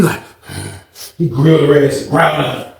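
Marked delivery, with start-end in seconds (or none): none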